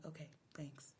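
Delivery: barely voiced, low acknowledgment; tiny hushed brush-off; whispered reply. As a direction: whisper a minimal answer. Retold meek reply to the clerk.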